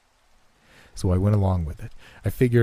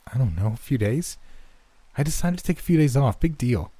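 higher-pitched, dismissive excuse; defensive brush-off; 6 semitones higher than usual. A narrator's reading. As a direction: shrug the question off with irritation. Evasive friend, defensive when questioned.

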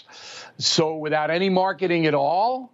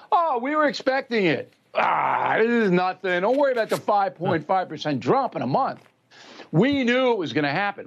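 high, whiny mock reassurance; mock Biden dismissing inflation; 4 semitones higher than usual. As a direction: wave the bad news away in a put-on voice.

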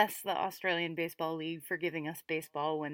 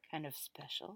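teasing whisper; 3 semitones lower, 12 dB quieter than usual. Real, not acted. Teasing the chosen player.

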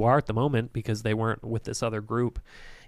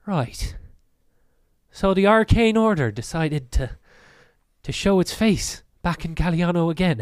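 higher, lilting voice with attitude; lightly voiced cocky troublemaker; sneering hooded stranger; 4 semitones higher, 4 dB louder than usual. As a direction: needle them with mock surprise. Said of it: "he sort of walks forward and he goes".

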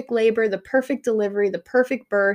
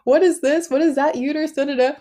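eager, higher curious questions; bright higher questioning, peppered out; curious husband; 6 semitones higher, 4 dB louder than usual. Retold or imitated. Husband quizzing the doctors over the open abdomen, eager and higher. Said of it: "asking questions".

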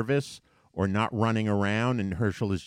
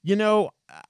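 raised, higher indignant scolding; mild mock outrage, pitched well up; heated reply; 8 semitones higher than usual. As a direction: argue back at her, voice up high. His heated reply to the woman.

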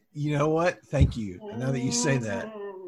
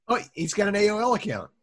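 raised, higher-pitched announcement; calling out; 6 semitones higher, 5 dB louder, faster than usual. Acted. Gang member announcing a discovery to the mob, calling out. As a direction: call it out high and loud.

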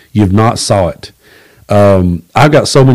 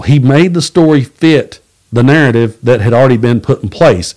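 usual speaking voice

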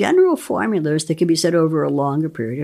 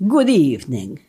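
low Hungarian accent, lightly done; a hint of Dracula greeting in Hungarian accent; 3 semitones lower than usual. Shown as a demonstration. Asked to repeat it for the host, mild Hungarian Dracula accent. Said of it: "Could you say that again?".

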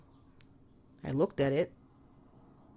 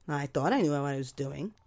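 ordinary delivery

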